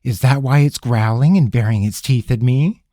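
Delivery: sarcastic question, lifted at the end. A narrator's reading. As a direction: ask it with heavy sarcasm. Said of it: "Ravenna asked sarcastically".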